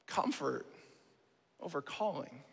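hushed punchline. Real, not acted. Hushed summing-up line.

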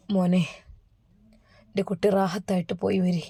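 faint blessing. Acted. Dying father blessing son.

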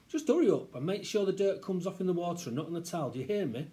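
nagging mum. Acted. Mum nags child about dirty hands, nagging. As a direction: hurry a child along crossly.

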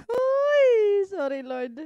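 high loud wailing "Uy"; mock cry. Real, not acted. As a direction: fake sob an apology to God. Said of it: "Lord, tabang". (pabirong iyak na paghingi ng tawad)

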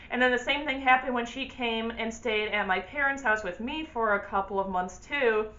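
ordinary talk, nothing marked

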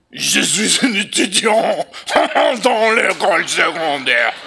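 mock French laugh-talk. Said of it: "French, okay. You just go".

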